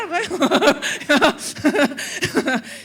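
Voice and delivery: loud, open laugh at her own joke; big laugh. Real, not acted. Pastor laughing at her own joke, loud laugh. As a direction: let a loud laugh burst out.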